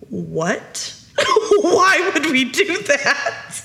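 hushed "what", then slow, high-pitched dismay through laughter; mock horror, laughing; horrified child. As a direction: imitate an appalled kid.

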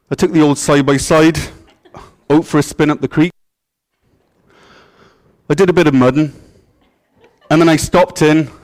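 put-on folksy Canadian accent. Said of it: "The Canadian accent's difficult".

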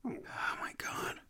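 whispering. Real, not acted. Shocked aside about the script.

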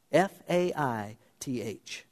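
F A I T H spelled letter by letter, slow and soft. Shown as a demonstration. Preacher spelling out FAITH to the congregation, slow and soft. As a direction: lay out each letter of F A I T H slowly. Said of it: "spelled out".

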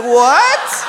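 a dad caught off guard, calling it out loud; mock shocked what. Dad reacting to his defiant toddler, loud mock shock. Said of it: "I was like".

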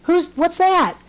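Harry on the video call, high and puzzled; puzzled boy. Retold or imitated. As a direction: squeak it out like a baffled little boy. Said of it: "And he's like".

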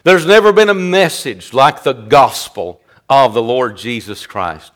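usual speaking voice

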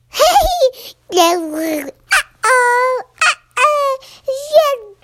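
put-on high squeaky baby babble. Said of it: "The baby was like".

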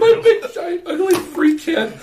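high, loud squeal through laughter; real laughing fit, words squeaking out; helpless laughing. Real, not acted. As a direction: gasp it out in hysterics. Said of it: "but I can't stop".

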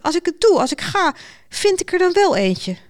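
high-pitched, pleading niece; floundering protest. A narrator's reading. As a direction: keep wriggling against the dismissal. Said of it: "spartelde het nichtje nog even".

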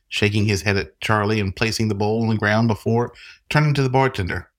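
ordinary talk

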